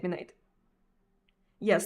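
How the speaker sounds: normal talking voice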